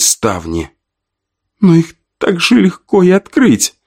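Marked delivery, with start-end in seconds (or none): 1.6-3.7 high, alarmed voice; panicked realization. A narrator's reading. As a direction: panic as it dawns on her. Frightened woman realizes danger.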